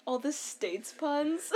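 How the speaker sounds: usual speaking voice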